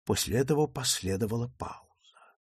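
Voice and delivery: ordinary delivery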